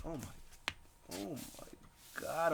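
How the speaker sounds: soft, slow, drawn-out oh my God; real breathy-voiceless dismay, dragged out slowly; dismayed groan. Real, not acted. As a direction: groan at your own creaky knee. His knee just cracked mid-talk.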